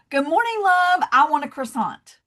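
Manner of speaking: short and sweet, one warm word; brisk friendly order. Retold or imitated. Customer ordering fast in New York, lightly cheery. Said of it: "I would go in and go".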